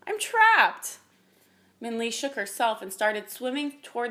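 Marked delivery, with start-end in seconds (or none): 0.1-1.0 high, loud tearful complaint; distressed creature, much higher; sniffling wail. A narrator's reading. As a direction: wail it pitifully. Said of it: "the dragon sniffled".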